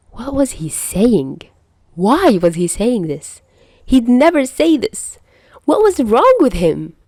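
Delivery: panicked. Acted. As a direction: spiral into flustered panic. Panicked inner thoughts.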